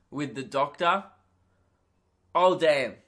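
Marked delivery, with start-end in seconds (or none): 2.3-2.9 smitten reaction. Retold or imitated. Smitten reaction to hot doctor.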